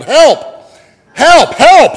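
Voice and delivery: rising panicked screams for help; panicked yelling. Acted. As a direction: yell for help in growing panic. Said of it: "and he yelled".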